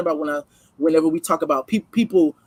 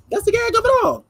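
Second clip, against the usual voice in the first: squeaky high, sing-song punchline. Shown as a demonstration. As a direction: jump way up high to sell the joke.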